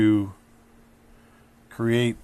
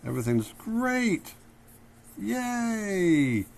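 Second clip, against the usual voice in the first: high, bright-eyed cheering, much higher pitch; happy cheer; 10 semitones higher, 5 dB quieter, much slower than usual. Acted. Teacher acting out a happy pose for students, high joyful cheer. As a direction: squeal with joy like a kid. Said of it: "When you're happy, you're like".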